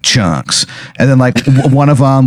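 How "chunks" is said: low, dropped-down one-word tagline; deadpan it to the camera; meathead catchphrase. Retold or imitated. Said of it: "he's like".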